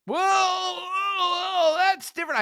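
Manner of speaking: very high-pitched imitated backpedalling; mock protest. Cornered heckler protesting to the speaker, high-pitched mock alarm. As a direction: object in an exaggerated high voice.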